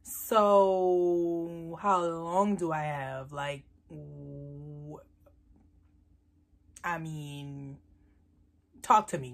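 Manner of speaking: very slow, drawn-out words sinking low; mock doomed question. Daughter asks mom how long she has left, mock gloom. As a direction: stretch it out like a dramatic death sentence.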